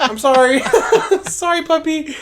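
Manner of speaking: high, loud apology through laughter; laughing apology. Retold or imitated. Apologizing to a smacked dog, laughing and high-pitched. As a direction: laugh while saying sorry to the puppy.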